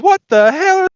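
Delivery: high, loud, slow mock amazement; astonished Texan. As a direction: stretch it out as a flabbergasted Texan. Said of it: "And he says".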